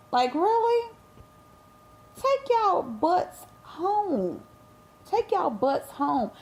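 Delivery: high, exasperated scold, drawn out slow. Real, not acted. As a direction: scold them like a fed-up mother. Scolding the crowd.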